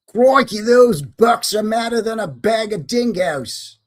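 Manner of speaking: loud, high, drawn-out fake Australian; mock Aussie accent. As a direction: belt it out in a hammed-up Aussie accent. Puts on Aussie catchphrase after laughing.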